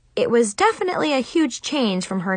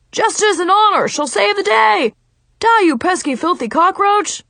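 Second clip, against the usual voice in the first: higher, louder superhero battle cry; hollering; 10 semitones higher, 5 dB louder than usual. A narrator's reading.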